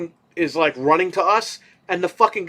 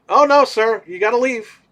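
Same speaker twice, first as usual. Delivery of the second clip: higher, louder mock guard; guard's voice; 6 semitones higher, 4 dB louder than usual. Guard refusing him, lightly voiced high polite insistence. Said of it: "I was like".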